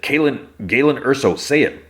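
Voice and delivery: mock-urgent push to repeat the name; insistent demand. Clea makes Andor repeat the name, insistent.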